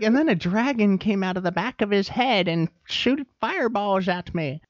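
put-on high, wavering little old lady. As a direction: play a sweet, rambling old lady.

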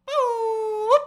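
very high, loud beep-like vocal noise; a piercing single boop; mock shower singing. Retelling deaf campers singing in the shower, high boop. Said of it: "secondhand impression of a deaf person singing".

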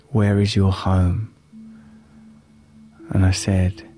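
calm, unhurried guiding voice. Acted. Meditation guide telling a story.

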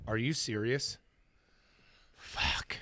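soft, exasperated cop muttering; muttered disgust. Retold or imitated. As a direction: grumble it under your breath. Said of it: "He's like".

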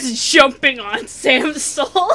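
loud, breaking into laughter; laughing. Real, not acted. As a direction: laugh through the words. Laughing at the image.

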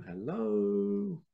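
ordinary talk

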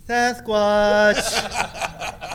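loud, high Bigfoot call, laughing; Sasquatch call. Pretend. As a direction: yell out a mock Sasquatch call. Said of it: "do the Sasquatch call".

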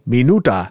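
minuta said as mee-NOO-tah, imitating Russian; Russian pronunciation. Shown as a demonstration. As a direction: demonstrate Russian minuta, stressing the middle "nu". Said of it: "it sounded like".

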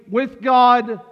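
"with God" called out high and loud. Shown as a demonstration. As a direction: lift "with God" to a triumphant peak. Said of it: "the drastic difference between".

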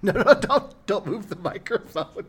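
high laughing no, no; laughing while stopping her; laughing protest. Real, not acted. Dad stopping child, laughing.

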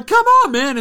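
loud, high, whining complaint at the ref; protesting Chris Paul. Retold or imitated. Chris Paul complaining to the ref about a flop, loud high whine. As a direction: yell it up high like a player begging for the call.

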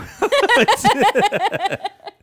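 loud high squealing laugh; high shrieking laugh. Real, not acted. Laughing hard at her cohost's gross joke, high shriek.

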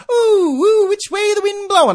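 high, loud mocking singsong; mock finger-in-the-wind cooing. Host mocks stars testing the wind, high mocking coo. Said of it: "stick their finger in the air and".

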